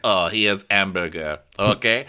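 hammed-up Parisian waiter with French accent; mock French accent. Parisian serving Americans a hamburger, mock French accent. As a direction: serve it up in a put-on French accent. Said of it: "when you're in Paris".

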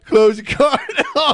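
squealed, laughing imitation; laughing mimicry. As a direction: parrot the line while cracking up. Mimics host's line, cracking up.

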